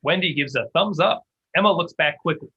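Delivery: normal talking voice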